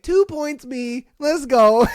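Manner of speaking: high, then a loud shout of triumph; gloating little victory shout; mock victory cheer. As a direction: cheer the win, shouting let's go. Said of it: "I'd be like".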